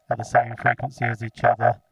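normal talking voice

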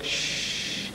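long voiceless shush; hushing shh. Acted. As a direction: breathe out a drawn-out "shh". Hushes after reciting the prayer.

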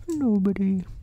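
high bear voice quoting a word; Pooh. A narrator's reading. Bear mulling over the answer he heard, high musing voice. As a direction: echo it high as a pondering bear. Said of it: "he thought to himself".

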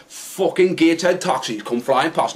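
usual speaking voice

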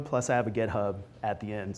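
ordinary talk

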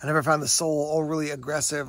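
ordinary talk, nothing marked

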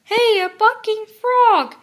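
put-on high, loud boyish shout; big eager yell; excited shout. A narrator's reading. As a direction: call it out with excitement. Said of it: "shouted Brother".

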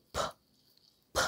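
P as an unvoiced puff of air; released English P. Shown as a demonstration. Teacher shows learners the English ending sound, puffed P. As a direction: pop the lips open on P, no letter name. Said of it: "then we will pronounce".

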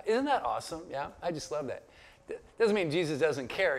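usual speaking voice

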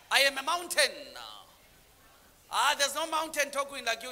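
ordinary delivery

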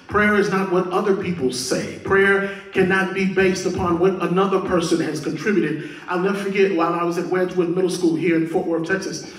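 pulpit preaching, punching the key phrases; emphatic preaching. Real, not acted. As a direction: drive the point home to the congregation. Preaches at force again.

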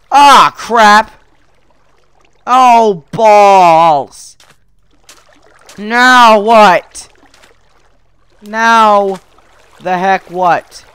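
loud frustrated outbursts; big, drawn-out exasperated yell; exasperated shouting. Real, not acted. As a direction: shout each curse out in frustration. Game keeps going wrong.

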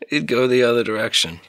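amused, breaking into short laughs; laughing. Acted. Officer recalling a cadet's early blunders, laughing. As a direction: chuckle around the words at a fond memory. Fond memory of clumsy cadet.

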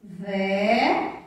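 VELA split slowly into ve, then la; measured VELA, long gap between syllables; dictation style. Shown as a demonstration. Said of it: "Vamos escrever a palavrinha vela".

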